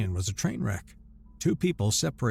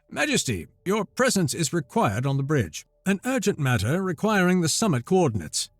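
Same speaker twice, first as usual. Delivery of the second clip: courtly aide, higher; formal urgent summons; soft aide voice; 6 semitones higher, 4 dB louder than usual. Acted. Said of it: "Cassian's voice came softly".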